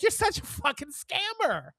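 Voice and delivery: high, amused scorn; jeering at the scammer, pitched high; mock scorn. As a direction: toss the insult with a grin. Retold jab at the scammer.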